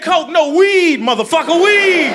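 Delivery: high, loud mocking voice; high mock voice insisting on weed; squeaky mock outrage. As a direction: squeal it like an offended partygoer. Retold party guest correcting.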